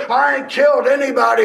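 loud protesting yell; mock scream of an accused man; pretend scream. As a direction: yell your innocence while being dragged out. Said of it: "I can scream all the way".

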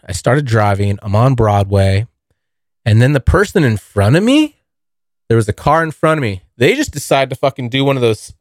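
ordinary delivery

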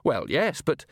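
ordinary delivery, nothing marked

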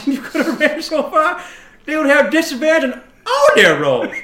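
loud, high, excited boast through laughter; laughing boast. Acted. Companion telling ma'am what they'd do, loud laughing boast. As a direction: blurt the boast while cracking up.

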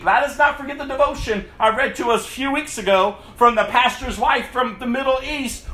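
ordinary talk, nothing marked

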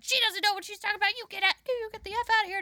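high, rapid-fire crackhead scolding; Rita. Retold or imitated. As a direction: cuss someone out in a mock shrill rush. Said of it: "Cusses this man out".

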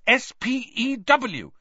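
high, slow, heated spelling-out. A narrator's reading. Hermione correcting Ron's mockery, hotly. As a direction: hammer each letter indignantly. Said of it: "said Hermione hotly".

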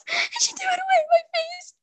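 tearful wail over the thrown-away paper; crying. Real, not acted. Child recalling the teacher who trashed her paper, crying. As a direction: voice breaking high into a sob.

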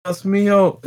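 playful put-on Mexican Spanish accent; mock Mexican accent. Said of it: "adding so much of an accent".

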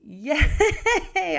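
loud high yay with a laugh; excited cheer. Real, not acted. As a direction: celebrate the trip to Paris. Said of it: "cheers after French greeting".

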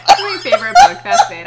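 high, loud laughing shriek. Real, not acted. Shrieking with laughter about her favorite book.

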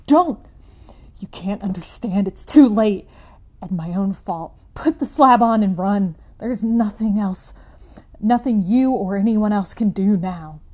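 loud, anguished despairing scream. Acted. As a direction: scream with utter hopelessness. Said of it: "changed to a scream of utter despair".

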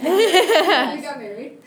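loud laughing cheer. Real, not acted. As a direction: burst out laughing as you say it. Gleeful echo of teacher news.

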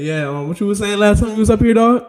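loud, high needling interviewer; Piers Morgan. Retold or imitated. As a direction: taunt him like a smug TV host, loud and high. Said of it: "They getting back on that shit and be like".

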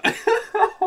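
sudden squealing laugh; high laugh. Real, not acted. Burst of laughter.

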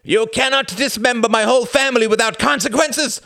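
high, loud furious shout. Acted. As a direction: yell it at the count in outrage. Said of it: "shouted Klaus".

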